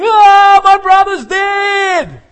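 loud, high desperate scream; a hammed-up panicked shout; screaming plea. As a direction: scream for help as if a brother died.

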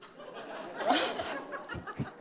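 high-pitched laugh at own story; real high laughter. Real, not acted. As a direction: laugh at your own embarrassing punchline. Laughs at her own punchline.

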